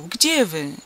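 searching call. A narrator's reading. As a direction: call out to find him in the dark. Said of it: "zawołał".

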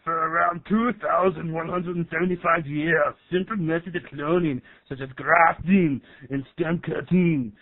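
computer narrator in an Austrian strongman voice; Arnold Schwarzenegger. Acted. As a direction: narrate science facts like an action hero. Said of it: "you guys got the rights to Arnold Schwarzenegger's voice?".

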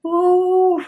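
long, loud, high ooh as tears well up; emotional ooh. Real, not acted. Overwhelmed while talking about the deaf kids, a loud tearful ooh. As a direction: let out a big shaky breath to stop crying. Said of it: "we're not crying".

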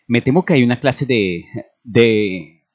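hesitant, faltering start; confused. A narrator's reading. Flustered doctor reporting trouble to his superior, confused and hesitant. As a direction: trail off, groping for the word. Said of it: "comenzó el hombre confundido".

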